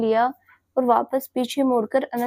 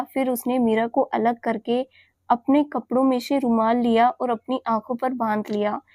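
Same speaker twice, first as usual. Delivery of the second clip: plain reading voice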